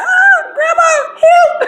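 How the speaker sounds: high, loud, drawn-out wail for help; panicked scream. Retold or imitated. Granddaughter retells goose opening its wings at her, mock panicked scream. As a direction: re-enact a terrified scream, hammed up. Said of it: "I was crying real tears".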